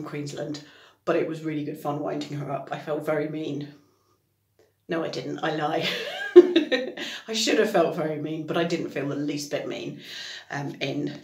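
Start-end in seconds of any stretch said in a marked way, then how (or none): 5.9-7.2 loud, high burst of laughter; a real laugh breaking out high and loud. Real, not acted. Laughing about winding up her friend, high burst of laughter.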